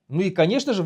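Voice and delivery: usual speaking voice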